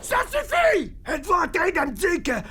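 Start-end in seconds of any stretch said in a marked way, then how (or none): 0.0-1.0 much higher, exasperated outburst; angry cut-off. Acted. Demon silences his fearful henchmen, angry outburst. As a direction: cut them off in anger. Boss cuts off frightened henchmen.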